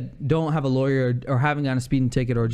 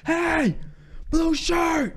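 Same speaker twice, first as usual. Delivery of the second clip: screaming; 14 semitones higher, much slower than usual. Retold or imitated. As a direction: holler at him from far off. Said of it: "So he's screaming".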